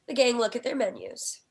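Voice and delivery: normal talking voice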